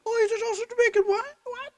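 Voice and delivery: very high, loud squeal of a surprised boss; hammed-up high-pitched boss voice; squeaky mock boss. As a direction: shriek it like a startled boss in falsetto. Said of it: "He's like".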